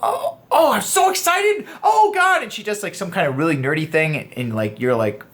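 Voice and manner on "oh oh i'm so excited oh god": very high, giggly squeal; imitating an excited girl. A girl gushing over something nerdy, in a very high giggly squeal. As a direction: gush like a giddy fan. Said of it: "If she just says something like".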